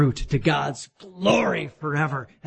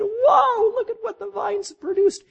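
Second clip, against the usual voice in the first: higher, delighted wonder at the harvest; amazed wow. Retold or imitated. Winemakers admiring the vines, with amazed delight. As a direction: marvel at the wine harvest. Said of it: "looked back and said".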